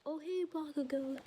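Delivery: put-on girl answering.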